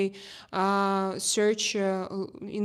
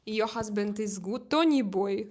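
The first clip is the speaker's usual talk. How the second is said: usual speaking voice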